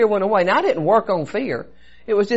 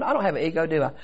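normal talking voice

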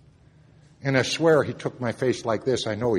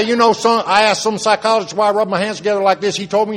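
lightly put-on Texas twang, higher and louder; 10 semitones higher, 9 dB louder than usual. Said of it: "he had his little twang".

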